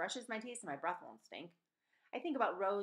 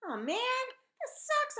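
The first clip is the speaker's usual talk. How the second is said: much higher, louder whine; whiny complaint; 6 dB louder, much slower than usual. Acted. Claire complains about having to stand, whining. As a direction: moan about the punishment.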